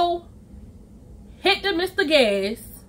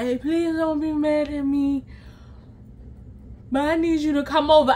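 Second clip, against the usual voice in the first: lightly voiced thick-tongued plea; hint at a swollen-tongued, droning voice; imitated slurred friend; 3 dB quieter than usual. Friend asking for a visit, lightly mimicked impaired speech. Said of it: "this is how she sounds".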